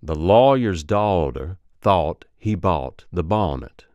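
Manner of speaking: slow Alabama accent, gliding aw vowels; Alabama accent drill. Shown as a demonstration. Coach modelling the aw change, slow Alabama accent. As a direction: stretch each aw into a Southern diphthong. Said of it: "it becomes aw".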